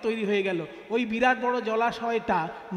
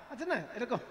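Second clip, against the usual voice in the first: ordinary talk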